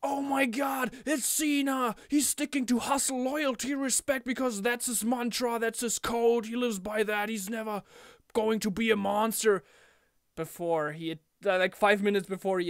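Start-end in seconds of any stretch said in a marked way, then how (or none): 0.0-9.6 higher, breathless commentator hype; mock announcer excitement, higher; Michael Cole impression. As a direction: gush like an announcer calling it. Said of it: "Cole was like".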